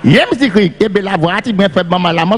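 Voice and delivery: usual speaking voice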